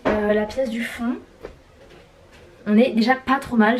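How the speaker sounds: normal talking voice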